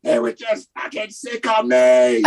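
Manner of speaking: put-on shrill laughing shout in an Irish accent; hammed-up Irish mum, outraged and laughing; an Irish mum shrieking through laughter; thick Irish mum. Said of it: "this thick Irish mum go".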